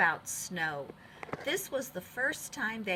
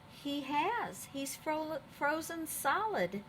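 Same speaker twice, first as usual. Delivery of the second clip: gasping; 7 semitones higher, slower than usual. A narrator's reading. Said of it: "gasped one of the elephants".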